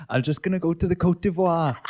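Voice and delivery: put-on laughing Welsh accent. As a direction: put on a Welsh lilt, giggling. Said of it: "if you do a fucking Welsh accent".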